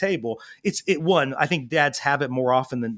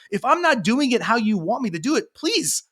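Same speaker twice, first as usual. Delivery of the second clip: put-on pleading kid; 10 semitones higher than usual. Kid begging dad for another way, high imploring voice. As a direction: voice climbing high in a kid's plea. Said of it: "the kid actually is like".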